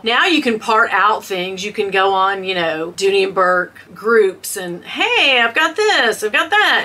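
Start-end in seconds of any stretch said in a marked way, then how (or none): 4.8-6.8 higher-pitched imitation of eager traders; imitated group members. Voicing bag group members swapping parts, higher imitated chatter. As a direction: mimic people offering parts, pitched up.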